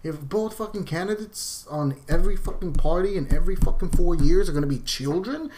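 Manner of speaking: loud, exasperated outburst; angry rant. Real, not acted. Vlogger ranting at both parties, loud and angry. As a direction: let the frustration blow up loud.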